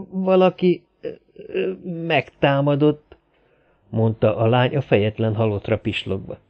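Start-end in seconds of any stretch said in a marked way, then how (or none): 0.1-3.2 halting, high, frightened stammer; trembling with fear. A narrator's reading. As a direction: stammer it out in terror. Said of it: "remegett a hangja a rémülettől". (rémülten, remegő hangon)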